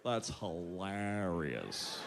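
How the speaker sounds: low, slow, soft drawl; imitating slack reaction. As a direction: drag it out low with no amusement at all. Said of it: "And he goes".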